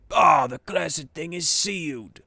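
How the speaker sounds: loud, slow angry shout; frustrated shout. Acted. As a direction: bellow at the sealed hatch.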